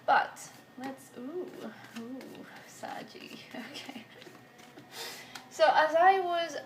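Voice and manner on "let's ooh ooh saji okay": soft breathy whisper, slowed down; whispered aside. Real, not acted. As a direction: whisper it to yourself while shuffling. Aside while shuffling cards.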